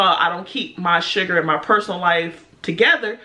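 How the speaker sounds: plain reading voice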